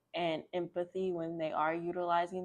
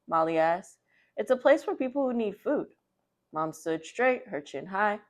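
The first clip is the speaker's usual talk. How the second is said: lively, louder picture-book read-aloud; reading to children; 6 dB louder than usual. A narrator's reading. Librarian reading a picture book to children, lively and loud. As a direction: read it out brightly to the kids.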